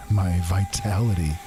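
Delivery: ordinary delivery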